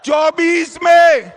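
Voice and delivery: shouted rally questions; booming political rally speech. Real, not acted. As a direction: rouse the crowd, loud. Rally call to the crowd.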